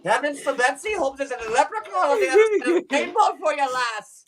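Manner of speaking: quick, lilting mock-Irish blessing; Irish accent. Performer giving a fan a leprechaun wish, quick Irish accent. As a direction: commit fully to a stage brogue. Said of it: "every time you try to do the accent".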